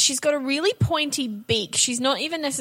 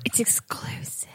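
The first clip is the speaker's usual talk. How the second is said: whisper; 8 dB quieter than usual. Pretend. Teasing Patreon-only exclusive aside.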